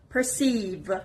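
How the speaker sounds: "perceive" as per-CEIVE, falling at the end; teacher's model "perceive", weight on the last syllable; stress on second syllable. Shown as a demonstration. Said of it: "The stress is on the second syllable".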